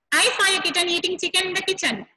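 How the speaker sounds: rattled off fast in one breath; fast tongue twister. Shown as a demonstration. Teacher showing children the fast way, rapid. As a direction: race through the twister as quick as you can. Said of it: "Can you say like this?".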